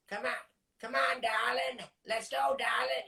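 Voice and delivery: a hint of Fran Drescher. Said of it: "I can talk like her".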